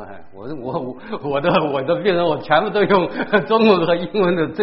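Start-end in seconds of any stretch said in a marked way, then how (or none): 2.2-4.6 laughing. Real, not acted.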